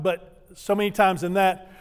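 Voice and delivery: ordinary delivery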